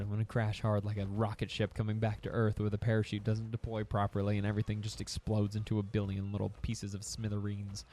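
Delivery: usual speaking voice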